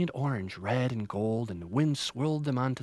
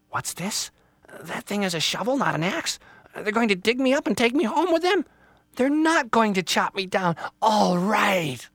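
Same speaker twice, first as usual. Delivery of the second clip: high little voice building loud; excited Little Tree; 10 semitones higher, 7 dB louder than usual. Acted. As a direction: go from puzzlement to jubilant relief. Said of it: "Little Tree was so excited".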